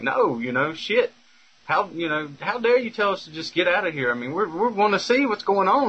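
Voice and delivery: indignant, high-pitched protest; retold fussing. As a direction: push back in outrage, voice climbing. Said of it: "we're basically fussing with this manager".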